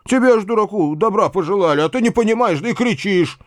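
high, aggrieved scolding; hurt, high-pitched reproach; reproachful. A narrator's reading. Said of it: "продолжал дядя с укоризной".

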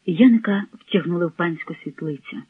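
ordinary delivery, nothing marked

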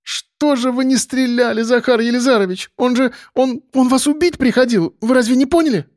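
put-on high, agitated girl's voice; high-pitched indignant reproach; reproachful young woman. A narrator's reading. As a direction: reproach him in a high, urgent voice. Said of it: "с укором покачала головой".

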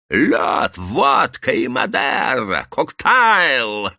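громко и высоко, водка и Мадера растянуто; кричи заказ на весь буфет; пьяный крик. Acted. Пьяный гуляка требует у буфета. (громкий пьяный заказ)

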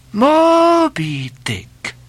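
high, slow, astonished cry; startled cry. Acted. Don Sebastian hearing the whale's name, crying out high and long. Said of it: "cried Don Sebastian".

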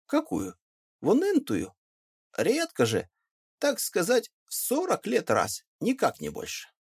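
a lightly voiced country guide; village guide. Peasant guide explaining to the gentleman how rarely it floods: high rustic voice.

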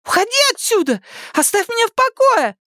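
very high-pitched shrieky demand; angry outburst. A narrator's reading. Daughter tells father to leave her alone, angry outburst. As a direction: drive him out in distress.

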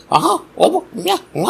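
very high silly gibberish chatter; mock chatter. Teaching a child about class chatter, mocking with high babble. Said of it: "అని మాట్లాడుతూ కూర్చోవచ్చా".

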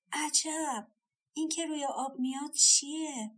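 put-on puzzled old woman, mildly amazed; astonished. A narrator's reading. Old woman spots something on the river, astonished. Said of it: "شگفت زده بر جا ماند و گفت".

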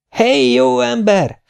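high, drawn-out woman's call; Hollóné hangja. A narrator's reading. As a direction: call out slowly to rouse someone. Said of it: "hallatszott Hollóné hangja". (magas, elnyújtott asszonyi kiáltás)

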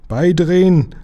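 high, loud captain's call; forceful, high-pitched command; shouted order. A narrator's reading. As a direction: shout the command across the deck. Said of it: "rief der Kapitän".

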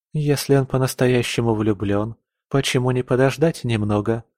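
plain reading voice

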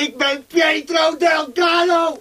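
high, slow, shouted insistence on his name; desperate shout. Acted. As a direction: yell his true name at them.

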